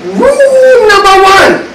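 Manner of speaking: high, slow mock kung fu master. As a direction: intone it like a martial arts sage. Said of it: "I should say it like a kung fu master".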